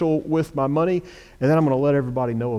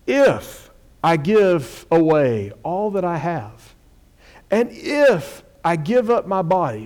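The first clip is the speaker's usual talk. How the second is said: both ifs raised high and slow; stress on if; 4 semitones higher, 3 dB louder, slower than usual. Shown as a demonstration. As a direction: lift each If well above the rest. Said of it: "emphasizing the word if".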